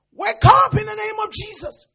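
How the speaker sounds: high, loud command; booming high command; commanding shout. Acted. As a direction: command the dead to rise. Peter raises Dorcas.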